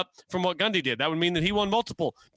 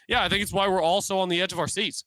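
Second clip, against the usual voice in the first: ordinary talk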